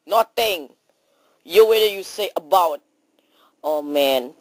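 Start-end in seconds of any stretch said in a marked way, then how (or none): none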